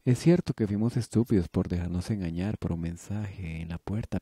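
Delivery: usual speaking voice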